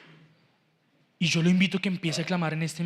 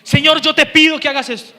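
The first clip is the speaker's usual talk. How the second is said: desperate plea; 6 semitones higher, 11 dB louder than usual. Shown as a demonstration. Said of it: "una iglesia desesperada diciendo".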